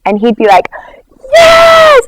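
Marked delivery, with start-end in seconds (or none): 1.3-2.0 very high, loud ecstatic shout; excited cheer. Retold or imitated. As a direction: shriek it with delight. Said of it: "so excited".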